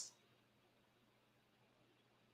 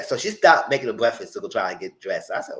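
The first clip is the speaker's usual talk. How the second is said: usual speaking voice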